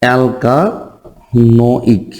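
"অ্যালকানোইক" very slow, with gaps between the parts; long-drawn, segmented "অ্যালকানোইক"; syllable by syllable. Shown as a demonstration. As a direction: spell out "অ্যালকানোইক" by sound for a pupil, al, ka, no-ik. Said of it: "এটুকুর নাম কি বলবো বাবা?".

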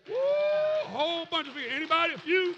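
high, loud, exclamatory pulpit shout; loud, rolling sermon cry; full-force preaching. Acted. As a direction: whoop it out and work the congregation.

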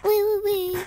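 high, singsong squeals of fun; playful wee. Pretend. Gamer playing about in the game, playful wee. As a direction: squeal like on a ride.

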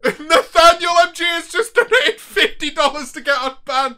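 high, laughing announcement; helpless laughter. Real, not acted. Streamer announcing a donation to get unbanned, high and laughing. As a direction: crack up while reading out the news.

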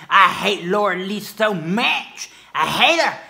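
imitating a furious troll; mock angry hater. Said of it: "somebody who's just really mad".

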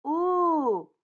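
put-on thrilled little-kid exclamation; excited shout. A narrator's reading. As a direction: cry out with sudden discovery. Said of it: "shouted Usha".

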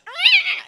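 high, loud screeching cat yowl; sudden high cat scream. Retold or imitated. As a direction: screech like a cat going nuts. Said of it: "she goes".

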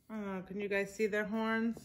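ordinary delivery

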